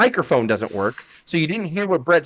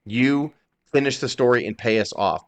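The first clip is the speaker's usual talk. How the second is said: ordinary delivery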